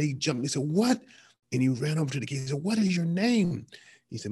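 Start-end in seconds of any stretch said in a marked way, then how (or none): 0.6-1.0 sudden high, loud outburst of disbelief; outraged what. Retold or imitated. Retelling Alexander confronting the boy, a shocked loud outburst. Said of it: "Alexander the Great got so upset". 2.6-3.7 much higher, louder, angry demand; upset demand. Retold or imitated. Conqueror confronting a deserter who shares his name, angry demand. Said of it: "Alexander the Great got so upset".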